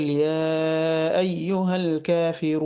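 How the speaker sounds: slow, measured tajweed chant; Quran recitation. Shown as a demonstration. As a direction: recite with long held vowels. (تلاوة مجوّدة بطيئة)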